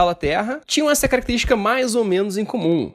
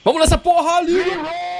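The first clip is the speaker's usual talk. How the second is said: excited shout; 11 semitones higher than usual. Acted. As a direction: yell it to kick things off. Calls out to start.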